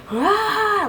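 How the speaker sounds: high, drawn-out mock scream; imitated toddler scream. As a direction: imitate a screaming toddler, without full force. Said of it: "screaming at the top of her lungs".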